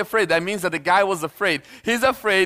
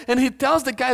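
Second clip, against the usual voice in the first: usual speaking voice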